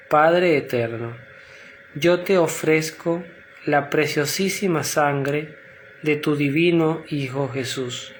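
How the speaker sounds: measured, phrase-by-phrase prayer others can repeat; slow recited prayer. Real, not acted. Prayer leader reciting for listeners to repeat in their minds, slowly. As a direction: say it slowly, pausing between phrases. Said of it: "yo la voy a decir despacio".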